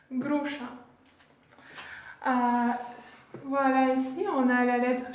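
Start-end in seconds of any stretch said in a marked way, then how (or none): 0.1-0.6 "Gruša" as GROO-sha; Russian pronunciation. Shown as a demonstration. Teacher repeating pear for learners, Russian pronunciation. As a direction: repeat "Gruša" with rolled r and sh as in French chat. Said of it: "on prononce gruša".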